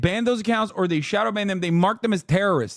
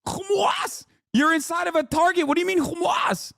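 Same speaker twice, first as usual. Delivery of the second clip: much higher, disbelieving shout; mock outraged shout; 11 semitones higher than usual. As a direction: yell it as if scandalized in a store. Mock shock at hearing Hamas.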